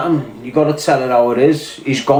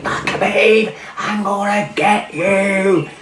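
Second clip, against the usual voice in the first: higher, slow, put-on taunting voice; mock McGregor taunt; 6 semitones higher, slower than usual. Mocking a fighter calling out his rival, in a higher, slow taunt. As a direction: drag out the threat like a cartoon fighter.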